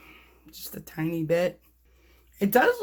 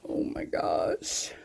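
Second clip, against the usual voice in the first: much lower, slow, hushed; awed whisper; 4 dB quieter, slower than usual. Real, not acted. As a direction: breathe it in awe. Close-up reveal.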